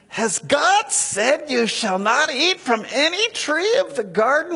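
high, insinuating serpent voice; sly serpent. Acted. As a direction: tempt her with a sly, lilting question. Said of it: "Now the serpent said to the woman".

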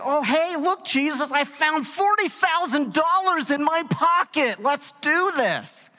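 mock cheery disciple. Disciple mocking Jesus's impossible request, silly high voice. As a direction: play the dopey disciple who found the cash. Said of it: "So they're like".

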